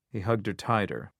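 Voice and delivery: usual speaking voice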